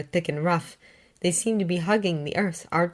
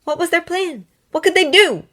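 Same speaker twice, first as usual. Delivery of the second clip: dramatic; 12 semitones higher, 7 dB louder than usual. A narrator's reading. Narrator's dramatic rhetorical questions.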